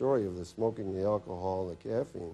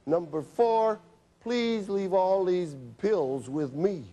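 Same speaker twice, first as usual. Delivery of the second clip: slow, weighted, every word laid down; slow firm prescription; 6 semitones higher, much slower than usual. Retold or imitated. Doctor reading his prescription to the nervous patient, slow and firm. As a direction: dictate it like an order that won't be argued with.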